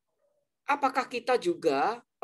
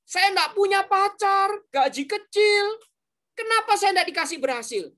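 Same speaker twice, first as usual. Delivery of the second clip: whiny complaint; 9 semitones higher, 3 dB louder than usual. Retold or imitated. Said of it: "Jangan kamu katakan".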